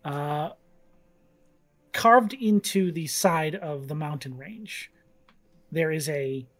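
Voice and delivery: lightly voiced scene-setting narration; game master narration. A narrator's reading. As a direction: paint the jungle calmly for players.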